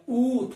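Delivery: उ said loud and held as a long oo; forceful long vowel. Shown as a demonstration. Teacher demonstrating the big vowel for students, उ forceful and drawn out. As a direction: push out a long, strong उ. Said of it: "थोड़ा जोर से बोलना पड़ता है".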